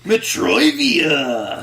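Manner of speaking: slow drawn-out game show call; announcer call. Pretend. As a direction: stretch it like a host.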